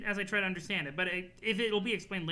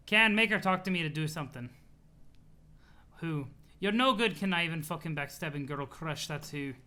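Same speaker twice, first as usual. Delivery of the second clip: CA. Acted. CA griping to Kanaya about a girl crush, testy accented voice. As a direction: put on a testy accented character voice. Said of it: "laughing at the C A voice".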